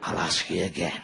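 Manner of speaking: put-on dad threatening the kids in the back of the car; Lee Van Cleef menace. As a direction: growl it like a spaghetti western villain. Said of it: "Lee Van Cleef".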